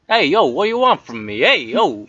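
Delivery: weasel with a Boston swagger. Acted. Weasel answering the player who questions him, Boston weasel voice. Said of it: "A weasel from Boston?".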